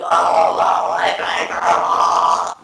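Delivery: loud anguished shout; crying out. Retold or imitated. Teen crying out as mom opens door. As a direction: wail it in pain. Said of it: "I'm like".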